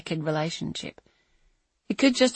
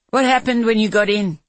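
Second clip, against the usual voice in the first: loud, higher urgent shout; 4 semitones higher, 7 dB louder than usual. Acted. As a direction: shout the question. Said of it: "he shouted".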